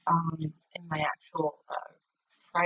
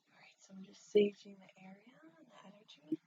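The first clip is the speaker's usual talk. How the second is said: whispering; 10 dB quieter than usual. Real, not acted. Whispering while family sleeps.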